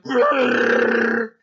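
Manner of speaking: loud, drawn-out creature roar; Chewbacca roar. Retold or imitated. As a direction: imitate a Wookiee bellow, high and loud. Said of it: "Chewbacca's like".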